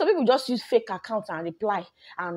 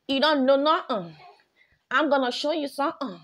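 defiant taunt; 6 semitones higher, 4 dB louder than usual. Real, not acted.

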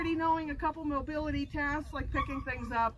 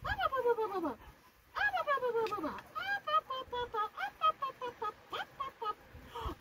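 high, fading chant of up; high coaxing; 7 semitones higher, 5 dB quieter than usual. Real, not acted. Trainer coaxing puppy up a step, high and fading. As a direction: coax her up the step, softer and softer.

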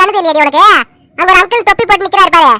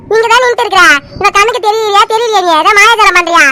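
ordinary delivery

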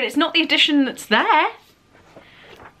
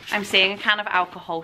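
ordinary talk

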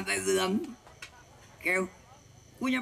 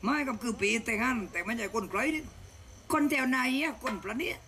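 put-on girlish sister voice; 6 semitones higher than usual. Voices the little sister, says ค่ะ.

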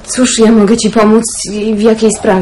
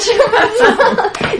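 high burst of joyful laughter; a delighted giggle; happy laugh; 8 semitones higher than usual. Acted. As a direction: laugh with relief and glee. Girl delighted aunt agrees.

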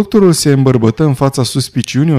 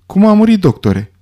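high-pitched start, dropping on the address; impatient; 7 semitones higher than usual. A narrator's reading. Lombard questioning the doctor about a death, impatient. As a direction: press impatiently for an answer. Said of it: "întrebă nerăbdător Lombar".

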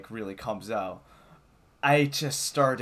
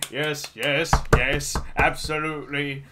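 put-on voice, a touch louder; mock parliamentary agreement; 3 semitones higher, 6 dB louder than usual. Mimicking House of Commons, hammed-up assent. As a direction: agree like a rowdy chamber.

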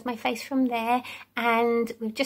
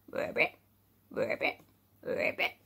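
frog croak; 8 dB quieter than usual. Shown as a demonstration. Frog croak imitation, soft.

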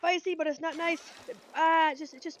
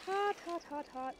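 mouth burning from hot food; 5 semitones higher, 4 dB quieter than usual. Real, not acted. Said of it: "my tongue is literally in pain".

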